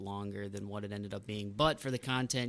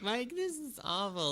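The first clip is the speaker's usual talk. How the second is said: mom voice; 12 semitones higher than usual. Retold or imitated.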